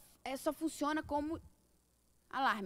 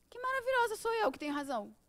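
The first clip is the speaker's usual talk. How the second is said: higher, louder self-admiring boast; mock self-importance; 8 semitones higher, 4 dB louder than usual. Speaker mimicking people who want to be right, mock self-importance. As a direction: put on a know-it-all's self-congratulation. Acting out someone always right.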